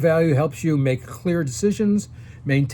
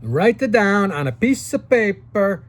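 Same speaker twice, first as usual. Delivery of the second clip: a light imitation of an Italian grandfather's accent, higher and louder; 7 semitones higher, 4 dB louder than usual. Grandfather Giovanni advising young Gregory, in a light Italian accent. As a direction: lightly voice an old Italian man's accent giving advice. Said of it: "In his Italian accent".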